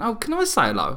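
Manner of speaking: put-on child voice. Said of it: "She went".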